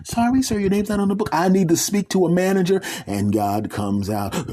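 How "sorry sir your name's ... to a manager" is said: high, entitled demanding voice. Acted. Man demanding a manager from the gatekeeper lady. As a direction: insist like a customer.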